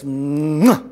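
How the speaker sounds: loud exaggerated kiss noise; big smooching kiss. A narrator's reading. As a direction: plant a noisy smacker. Child kisses father.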